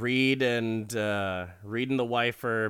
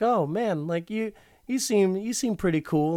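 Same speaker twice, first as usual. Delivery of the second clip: higher and louder, gushing; flirty wife; 10 semitones higher, 3 dB louder than usual. Retold or imitated. Wife flirting with the salesman, higher and gushing. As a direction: bat your eyelashes with the voice.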